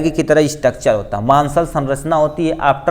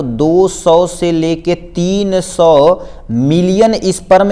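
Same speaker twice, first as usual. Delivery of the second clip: very slow, drawn-out "दो सौ से लेके तीन सौ मिलियन"; धीमा ज़ोर; 2 semitones higher, much slower than usual. Shown as a demonstration. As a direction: stretch out each part of "तीन सौ मिलियन" for weight. बड़ी संख्या पर ज़ोर देता शिक्षक. ("तीन सौ मिलियन" खींचकर, बहुत धीरे)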